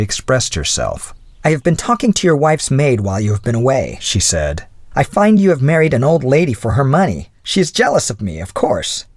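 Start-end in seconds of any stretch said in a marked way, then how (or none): 1.4-9.1 put-on higher, haughty young woman's voice; offended young lady. Offended young lady accusing a married man, higher haughty voice. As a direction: play a young lady who feels slighted.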